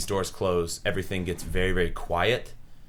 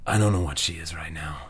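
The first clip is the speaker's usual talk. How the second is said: whisper; 2 semitones lower, faster than usual. Acted. NPC answer in the dark.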